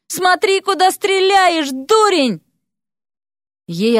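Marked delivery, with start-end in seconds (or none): 0.1-2.4 high-pitched, drawn-out holler; indignant yell. A narrator's reading. Vasya yells at whoever nearly pierced her, indignant. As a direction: yell at a careless archer. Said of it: "заорала, что есть мочи".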